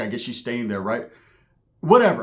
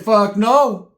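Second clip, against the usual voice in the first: higher, louder flat no; repeating the refusal to his homie, louder and higher; louder retold refusal; 7 semitones higher, 7 dB louder than usual. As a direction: slam the refusal down harder. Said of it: "I said".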